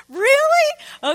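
high, loud disbelieving squeal; preacher reacting to the prophet's demand with a high, incredulous squeal. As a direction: act shocked at the nerve. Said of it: "I'm like".

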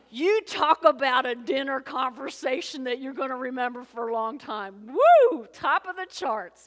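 loud, whooping preacher excitement; excited exclamation. Real, not acted. Preacher exclaiming over the dramatic story.

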